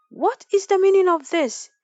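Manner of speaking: high, loud furious roar; outraged chief bellowing; thundering chief. A narrator's reading. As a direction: thunder it at the servant. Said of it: "he thundered".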